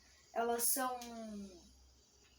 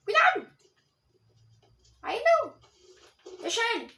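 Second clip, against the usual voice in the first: high, loud warning shout; alarmed shout; 10 semitones higher, 12 dB louder, faster than usual. Real, not acted. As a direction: yell a sudden warning. Danger during fight.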